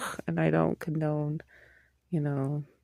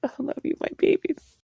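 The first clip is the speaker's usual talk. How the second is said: doting squeal; 11 semitones higher than usual. Real, not acted. Vlogger signing off to her viewers, high doting coo. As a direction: gush at them like your babies.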